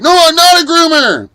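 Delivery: very high, loud denial; indignant yell. Retold or imitated. Hank yelling his denial back at Snafu Snaps. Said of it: "And then he's like".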